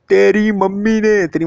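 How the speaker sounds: high, slow drawn-out mock voice; girl's answer. Tara revealing who sent her, high slow mock voice. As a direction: stretch it out teasingly high. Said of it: "तो कहता है".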